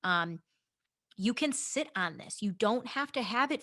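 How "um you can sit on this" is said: ordinary delivery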